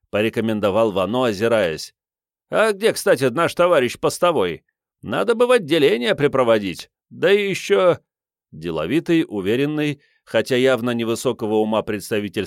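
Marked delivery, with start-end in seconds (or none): 2.5-8.0 pompous official. Acted.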